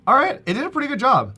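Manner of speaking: delighted and loud; excited. Real, not acted. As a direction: burst out pleased at the result. Pleased with the result.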